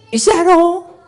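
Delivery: pitched high, pointed challenge; challenging question. Retold or imitated. Student challenging his master, high pointed question. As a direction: throw the question back at him.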